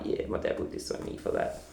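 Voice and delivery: low, breathy, unvoiced mutter; muttered reply. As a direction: mutter it under your breath, half-joking. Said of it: "I'm like".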